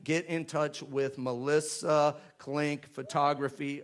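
ordinary talk

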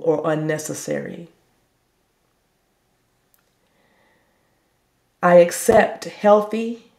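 slow, deliberate phrases with long pauses; slow affirmation reading. Shown as a demonstration. Meditation leader has listeners repeat a healing affirmation, slow reading. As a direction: leave room for listeners to repeat each phrase. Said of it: "I'm gonna read it, um, kinda slow".

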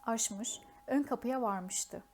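plain reading voice

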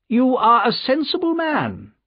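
high-pitched, condescending nobleman; patronising aristocrat, pitched high; haughty Marquis. A narrator's reading. Haughty Marquis voiced in story.

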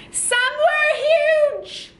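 high, loud drawn-out call; big high shout for children to copy; really loud. Shown as a demonstration. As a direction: belt it out as if huge. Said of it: "we're gonna be really loud".

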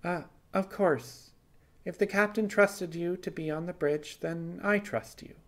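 lightly voiced Simon, brushing it off; Simon's voice. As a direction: play it off, flustered.

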